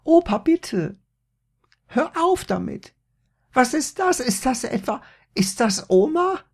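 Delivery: viel höher und lauter, voller Panik; ganz hohe Stimme. A narrator's reading. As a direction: quiek entsetzt in der Kopfstimme. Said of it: "bekam plötzlich eine ganz hohe Stimme". (Maria fleht mit ganz hoher Stimme)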